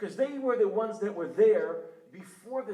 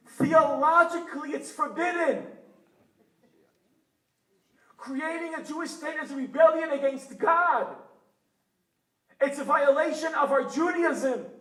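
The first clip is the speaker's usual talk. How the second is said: mock Haredi outcry; 8 semitones higher, 10 dB louder than usual. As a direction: shout it out like an outraged Haredi elder. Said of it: "what will the Haredim say?".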